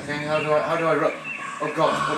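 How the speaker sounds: usual speaking voice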